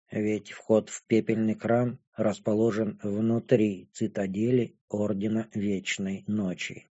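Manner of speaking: low, soft, slow, muted voice; impassive dragon girl. A narrator's reading. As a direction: explain it quietly, without emotion.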